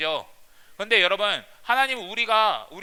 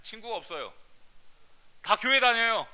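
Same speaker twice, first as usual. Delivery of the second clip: put-on lightly whiny teen voice; a hint of a sulky student making excuses; mimicking the kids. Preacher mimics his students' excuse, mildly sulky. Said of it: "우리 애들이 제일 많이 하는 말".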